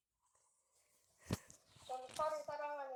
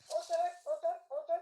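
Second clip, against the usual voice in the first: loud mocking jeer; taunting; 3 semitones higher, 9 dB louder than usual. Real, not acted. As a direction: jeer at the opponent. Taunting opponent mid-fight.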